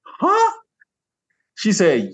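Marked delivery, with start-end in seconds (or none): 0.1-0.5 high-pitched puzzled yelp, retold; baffled. Man replying to a lady's accusation, baffled. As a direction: act out total bafflement, pitched way up.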